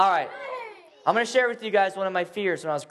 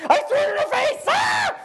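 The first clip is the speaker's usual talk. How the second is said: very high, loud yell; triumphant shout; 4 dB louder than usual. Retold or imitated. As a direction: shout the punchline. Climax of spider story.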